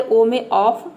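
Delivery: ordinary talk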